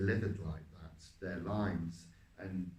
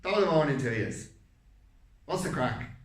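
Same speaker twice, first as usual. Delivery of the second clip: loud, high, quick Irish greeting; Irish accent; 5 semitones higher, 8 dB louder than usual. Shown as a demonstration. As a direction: jaw forward, belt it out in Irish. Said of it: "I'm trying to deliver it from there".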